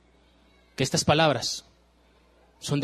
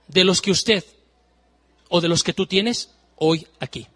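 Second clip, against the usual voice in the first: normal talking voice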